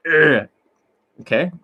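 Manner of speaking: ordinary talk, nothing marked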